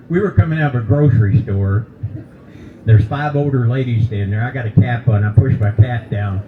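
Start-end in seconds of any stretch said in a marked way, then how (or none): none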